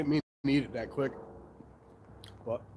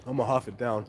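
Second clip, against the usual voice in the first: chewing while talking; 6 semitones lower than usual. Real, not acted. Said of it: "Sorry for chewing in the mic".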